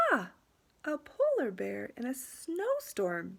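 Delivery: lightly voiced teacher, playfully musing; a hint of an encouraging art teacher; teacher character. Teacher looking at the blank paper, lightly playful.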